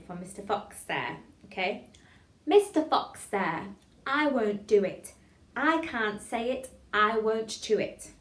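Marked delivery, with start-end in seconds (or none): 2.5-8.1 slow, loud, every word articulated; tongue-twister recitation. Shown as a demonstration. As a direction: chant it deliberately for a class to follow. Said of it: "we'll go from the beginning".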